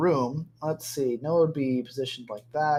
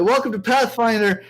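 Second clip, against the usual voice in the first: loud, raised-pitch fake host farewell; mock show sign-off; 5 semitones higher, 6 dB louder than usual. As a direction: sign off the show in a pretend huff. Mock show sign-off, then says kidding.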